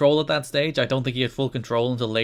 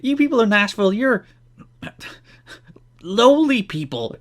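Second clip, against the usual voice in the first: Raven insulting Nashville; 9 semitones higher, 4 dB louder than usual. Retold or imitated. Raven fumbling an insult at Nashville fans, mock sneer. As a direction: sneer at the crowd and come up empty. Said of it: "and it's like".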